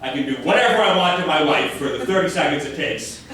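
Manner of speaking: ordinary talk, nothing marked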